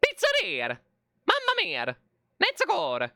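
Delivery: high-pitched cartoon Italian cries; hammed-up Italian accent bursts; mock Italian. Mock-Italian exclamations during gameplay.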